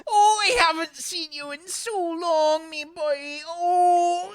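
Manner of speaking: high, slow, crying with joy; teary mum. Acted. Mum telling Arthur she hasn't seen him, high weepy voice. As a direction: gush like a mum missing her boy. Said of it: "And she says".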